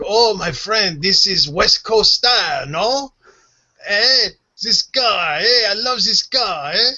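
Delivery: put-on high, excited French Canadian accent. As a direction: imitate an enthusiastic French Canadian, drawn-out vowels. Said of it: "that French Canadian".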